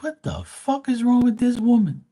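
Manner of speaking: high-pitched, loud retold disbelief; incredulous outburst. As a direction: voice jumps way up, baffled and appalled. Retelling his shocked reaction.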